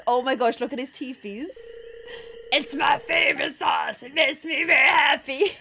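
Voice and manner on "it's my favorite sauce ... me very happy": put-on cartoon bear voice; a happy bear growling out its delight; hilarious bear. Bear delighted with its favorite sauce, comical bear voice. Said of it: "That's how bears sound".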